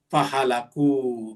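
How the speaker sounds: ordinary delivery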